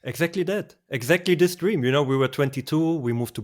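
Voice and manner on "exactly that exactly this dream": ordinary delivery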